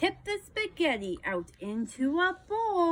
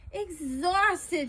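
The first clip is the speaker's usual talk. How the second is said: ordinary delivery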